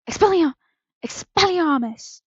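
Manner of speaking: high, loud spell cry; roared. A narrator's reading. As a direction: roar out the incantation. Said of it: "Snape roared".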